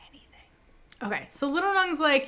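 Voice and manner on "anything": breathy whispered word; hushed suggestive whisper. Acted. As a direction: whisper it like a secret. Stressing the promise secretly.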